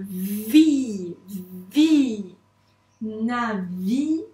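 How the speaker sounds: VI stretched with long v, then joined as NAVI; syllable by syllable. Shown as a demonstration. Teacher assembling syllables for a pupil, drawn-out navi. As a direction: sound out vi and attach it to na. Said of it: "syllabe par syllabe".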